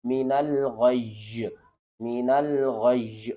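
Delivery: slow, stretched Quranic reading of من الغی with the doubled یا held; drawn-out recitation. Shown as a demonstration. Teacher showing students how to recite, drawn out. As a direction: recite من الغی slowly, lingering on the final یا. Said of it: "تو کس طرح پڑھیں گے؟".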